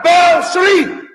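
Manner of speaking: loud high yell; shout. Acted. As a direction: belt it out. Shouting gag in clip.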